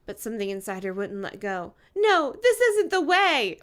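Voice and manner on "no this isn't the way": put-on high, loud, slow dramatic girl voice; Enid protesting. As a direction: pull away and cry out as the heroine. Enid resisting Ronnie, voiced by reader.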